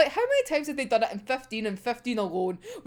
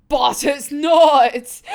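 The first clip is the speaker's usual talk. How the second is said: laughing; 8 dB louder, much slower than usual. Real, not acted.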